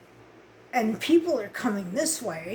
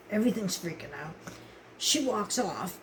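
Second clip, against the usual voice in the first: ordinary talk, nothing marked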